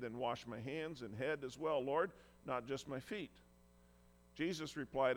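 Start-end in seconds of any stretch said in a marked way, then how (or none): none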